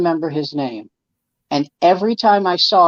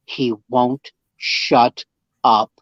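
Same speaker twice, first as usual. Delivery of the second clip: put-on lower, slow, weary voice of the old comic; imitating the older comic; 6 semitones lower than usual. Older comic complaining to the host about a lying colleague, low and slow. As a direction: drag it out low and slow, fed up.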